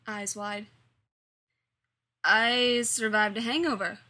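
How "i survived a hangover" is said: ordinary delivery, nothing marked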